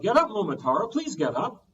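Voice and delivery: high, loud urgent plea; old woman begging the boy to wake, urgent; pleading cry. Acted. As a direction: shake him awake with your voice. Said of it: "The old woman cried".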